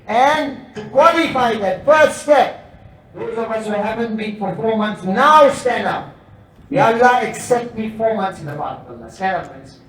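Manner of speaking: loud urgent appeal; rousing call to stand. Acted. Preacher rousing brothers to stand up, loud and urgent. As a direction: push them to their feet.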